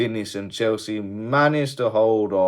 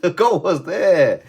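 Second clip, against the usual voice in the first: laughing disbelief; 11 semitones higher, 9 dB louder, slower than usual. Real, not acted.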